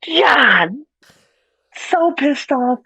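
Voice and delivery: real annoyance at a bad ending; exasperated outburst. Real, not acted. Venting about the movie ending.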